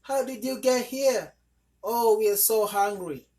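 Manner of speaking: hungry little ones pleading; children's voices. A narrator's reading. Children telling their mother they are hungry, high child voices.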